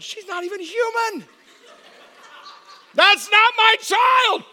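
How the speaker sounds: high, protesting wail that builds to a shout; mock horror. New father appalled by newborn's looks, mock horror. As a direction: cry out in comic disbelief.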